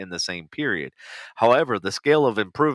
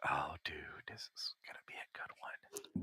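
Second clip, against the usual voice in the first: whispering; 6 semitones lower, 22 dB quieter than usual. Real, not acted. Whispered aside before reading.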